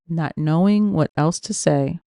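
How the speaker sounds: plain reading voice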